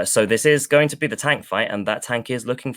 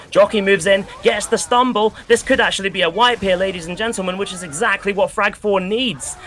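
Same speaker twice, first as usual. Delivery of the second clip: higher, louder caster call building to a hype peak; rising excitement, hyped play-by-play; live play-by-play; 7 semitones higher, 5 dB louder than usual. Acted. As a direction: call the fight like an esports caster as it heats up.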